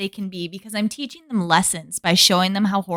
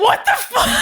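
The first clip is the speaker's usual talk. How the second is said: laughing outburst; 14 semitones higher than usual. Retold or imitated. Recalls her reaction to Dan's reveal, laughing shout. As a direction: shriek it through a laugh at the twist. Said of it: "I was like".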